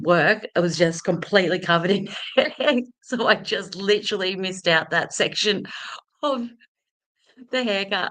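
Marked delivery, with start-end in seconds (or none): none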